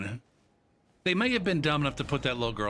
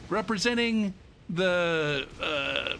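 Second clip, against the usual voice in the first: high, slow stadium announcer call; 7 semitones higher, slower than usual. Retold or imitated. Mimicking the stadium announcer introducing each kid, announcer voice. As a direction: boom it out like the PA announcer. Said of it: "And then".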